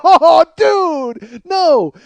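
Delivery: high, loud cry of disbelief; horrified dismay. Retold or imitated. Guard as the crowd turns on him, horrified dismay. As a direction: wail it as trouble dawns.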